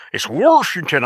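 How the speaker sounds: "Washington" said as "Warshington"; mocked regional pronunciation. Quoting the boss in the argument, "Washington" as an insistent "Warshington". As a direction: put an R in "Washington" and swoop up on it. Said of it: "nobody says it like that".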